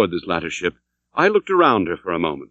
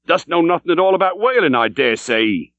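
put-on a gruff old sea captain, pitched higher, pressing the question; demanding old captain; 7 semitones higher than usual. A narrator's reading. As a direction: challenge the stranger like a suspicious old skipper. Captain mocks newcomer.

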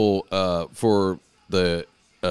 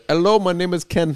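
high mock Indian greeting; put-on Indian call-center voice; Indian accent; 9 semitones higher, 3 dB louder than usual. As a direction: imitate an Indian agent introducing himself. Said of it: "your Indian voice is awesome".

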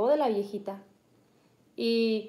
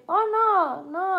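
high-pitched mock yell; half-yelled imitation, pitched up; imitated yelling; 7 semitones higher, much slower than usual. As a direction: mimic how they holler when talking. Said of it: "así gritan, así hablan".

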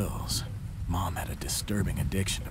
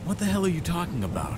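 higher, fast, furious disbelief; shocked angry outburst; 10 semitones higher, 4 dB louder, faster than usual. Acted. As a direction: yell it out in shocked rage. Brother shocked by mother's death.

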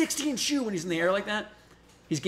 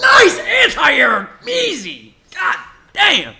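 high, drawn-out excited yells; Aqua-like shouting; 10 semitones higher, 10 dB louder, much slower than usual. Real, not acted. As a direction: shout the hype call, stretched and high. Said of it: "Why do you sound like Aqua?".

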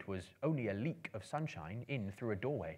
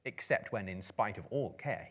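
usual speaking voice

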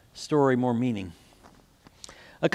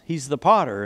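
usual speaking voice

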